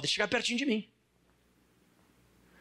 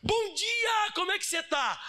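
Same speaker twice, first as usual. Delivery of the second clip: cheery greeting; 8 semitones higher than usual. Retold or imitated. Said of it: "A pessoa diz assim".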